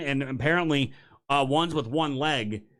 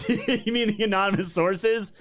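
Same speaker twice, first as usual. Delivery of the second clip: laughing through the words, higher pitch; high, drawn-out laughing question; incredulous laugh; 6 semitones higher than usual. Real, not acted. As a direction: laugh in disbelief as you ask it. Incredulous laugh at retold excuse.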